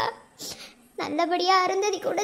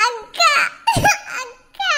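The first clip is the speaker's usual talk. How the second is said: crying out; 6 semitones higher, much slower than usual. Acted. Siblings crying at parting.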